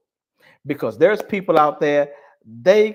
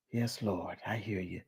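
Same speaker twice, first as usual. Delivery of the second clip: reverent inner voice; 7 semitones lower, 15 dB quieter than usual. Retold or imitated. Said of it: "Her mind is saying".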